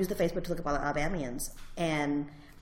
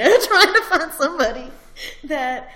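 laughing; 8 semitones higher, 12 dB louder than usual. Real, not acted. Laughs mid-sentence.

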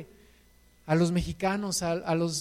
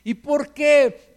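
lento, fuerte y marcado; pregunta enfática; 6 semitones higher, 7 dB louder, much slower than usual. Real, not acted. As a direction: alarga cada palabra para enfatizar. (¿Y por qué?, lento y fuerte)

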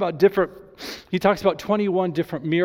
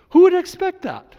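high, loud burst of disbelief; astonished question; 11 semitones higher, 8 dB louder than usual. Acted. Preacher to congregation on Jesus sharing his authority, astonished. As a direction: exclaim it, pitched way up, incredulous.

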